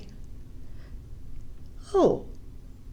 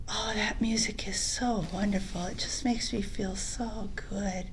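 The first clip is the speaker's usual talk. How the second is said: soft wonder; 8 dB quieter than usual. Acted. Boy enchanted by music.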